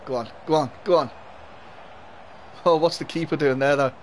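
ordinary talk, nothing marked

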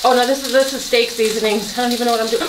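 ordinary delivery

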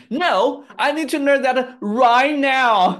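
loud, high, stubborn demand; high, emphatic declaration of impatience; insistent outburst. Real, not acted. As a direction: stretch it out in a playful tantrum. Playful outburst, insisting on learning now.

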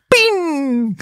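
high mocking 'ping' noise; ping it like a joke that bounced off; mock ping sound. The prankster backstage mocking a failed gag with a high ping. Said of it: "como diciendo, "Rebotaste".

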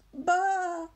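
high, loud sheep call; calling bleat. Acted. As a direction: bleat out to the distant voice. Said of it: "called Daisy".

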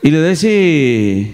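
normal talking voice

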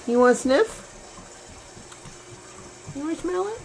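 high, rising pet-talk coo; high sing-song question to a kitty. Real, not acted. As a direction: coax the cat in a high voice. Coaxing a pet to sniff.

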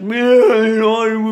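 loud, slow, high put-on voice; acted quote. Quoting what was said to him, loud and slow. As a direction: stretch the words out loudly in a strange voice.

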